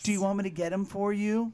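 提高音调，催促地问; 学妈妈急切地追问; pushy mom. Retold or imitated. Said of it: "her mom's like".

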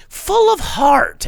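high, loud, drawn-out gush; gushing shout. Acted. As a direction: belt it out like a swooning fan. Gushing over a robot movie.

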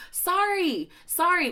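high, indignant "sorry?"; incredulous sorry; outraged sorry. Real, not acted. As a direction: fling out a shocked high sorry. Outraged disbelief at Fergal.

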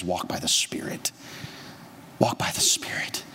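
hushed whisper of Jesus's words. Acted. Pastor whispers Jesus's words to the congregation. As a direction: breathe it like a secret.

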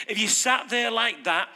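normal talking voice

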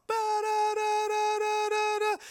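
high, loud slow siren mimicry; imitated fire alarm. Preacher imitating the fire alarm in the meeting, high and loud. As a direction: ring it out like an alarm.

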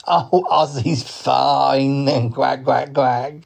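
very drawn-out cartoon duck voice; slow duck drawl; easygoing duck. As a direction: stretch the words like a chuckling duck. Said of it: "he is a duck".

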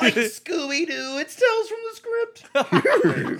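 high, slow Shaggy impression. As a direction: play it like Shaggy, laughing.

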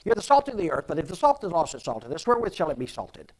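loud, high, fast preacher rattling off a verse; rattling preacher quote. Retold or imitated. Mocking the old preachers who quoted verses fast, loud and high. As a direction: race through the verse like an old-time preacher showing off. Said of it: "you know how preachers are when they quote verses".

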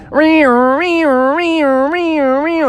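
high repeated siren imitation; car alarm wail. Car alarm blaring at the campsite, high mock siren. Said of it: "so it started going".

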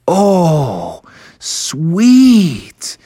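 drawn-out, delighted reaction; impressed. Acted. Bear family admiring a dinosaur, impressed.

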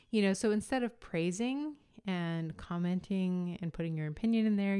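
usual speaking voice

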